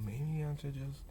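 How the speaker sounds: softly reined-in self-talk; hushed, hesitant aside to oneself; toning it down. Acted. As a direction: dial the volume back, tentatively. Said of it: "don't regulate within the moment and go".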